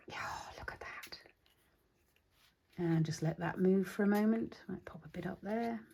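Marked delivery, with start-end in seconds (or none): 0.1-1.2 breathy awed whisper. Real, not acted. Marvelling at ink spreading. 4.7-5.8 soft unvoiced whisper. Real, not acted. Working quietly on the tag.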